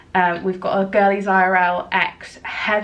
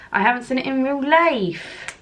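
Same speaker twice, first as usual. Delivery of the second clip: a half-hearted stab at a Suffolk accent, a bit higher; attempted Suffolk accent; 5 semitones higher than usual. Shown as a demonstration. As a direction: try a Suffolk accent and miss. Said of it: "that is not a Suffolk accent".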